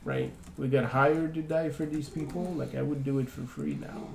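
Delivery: quiet and serious, low; sober, hushed sincerity. Acted. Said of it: "very uncharacteristically, like, serious".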